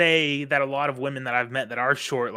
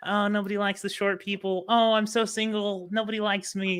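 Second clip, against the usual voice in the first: high mock-pitiful voice; mock whiny girl; 8 semitones higher than usual. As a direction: parody a self-pitying girl. Mocking pick-me girls.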